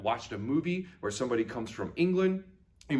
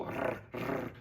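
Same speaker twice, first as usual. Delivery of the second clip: soft rolled-R snarl; tongue-trilled dog growl; 9 dB quieter than usual. Shown as a demonstration. Teacher showing pupils how to practise, soft trilled growl. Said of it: "get your tongue going with a little".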